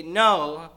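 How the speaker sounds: a loud, drawn-out "no"; emphatic mom's no. Retold or imitated. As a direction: stretch out "no" like a firm mom. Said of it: "with an emphatic no".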